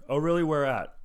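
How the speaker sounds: high, loud mock disbelief; sarcastic. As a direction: pretend to be impressed. Mocking bartender claim again.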